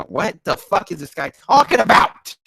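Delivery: high, loud incredulous question; rising to an indignant yell; baffled outburst. Real, not acted. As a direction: burst out in exasperated bafflement. Reader baffled, bursts out.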